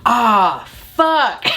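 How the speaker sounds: drawn-out sigh-word, then pitched high; retold dismayed realization. Grandma learns she took someone's wheelchair, mock dismay. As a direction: imitate a sinking oops moment, hammed up.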